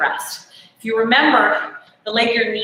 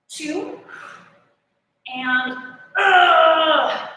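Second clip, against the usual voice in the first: straining; 5 semitones higher, much slower than usual. Real, not acted. Counting reps under strain.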